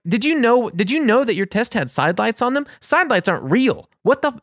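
high, incredulous rant; exasperated, pitch climbing; retold freak-out. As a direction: re-enact losing it at the clerk. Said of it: "I freaked out".

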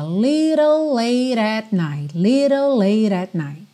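LITTLE LATE AT run together, "lirol leirat"; flapped Ts. Shown as a demonstration. Teacher shows students the linking, tapped Ts. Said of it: "ligações com rarará nas letras T's".

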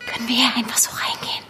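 fast, hushed whisper, sneaking in. Acted. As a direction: whisper so no one hears you. Heimlich im Krankenhaus. (hastig geflüstert, heimlich)

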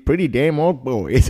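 slow Texan drawl; Texas accent. Retold or imitated. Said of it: "diesen mega Texas, äh, Slang raus".

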